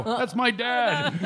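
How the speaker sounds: high, drawn-out exclamation through laughter; slow it right down, high and laughing; retold reply. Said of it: "And I go".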